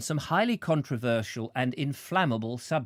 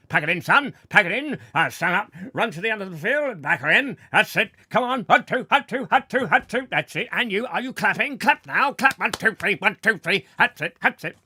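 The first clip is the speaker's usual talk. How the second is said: high, loud barked drill calls; march them about, shouting cadence; drill sergeant; 7 semitones higher, 6 dB louder, faster than usual. Acted.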